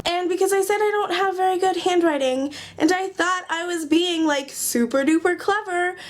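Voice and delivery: ordinary delivery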